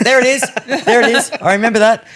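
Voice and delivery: thrilled to hear it back; excited. Retold or imitated. Hearing the recording again, high and excited.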